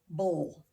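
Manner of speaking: "bowl" as the -ble of table; "bowl" standing apart, like the end of table; isolated syllable. Shown as a demonstration. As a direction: say "bowl" alone as one distinct syllable. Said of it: "Second syllable".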